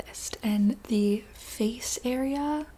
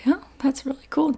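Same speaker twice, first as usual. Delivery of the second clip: higher, louder show of interest; friendly enthusiasm; 4 semitones higher, 5 dB louder than usual. Acted. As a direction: react with upbeat, encouraging interest. Player's reply to fish's life story.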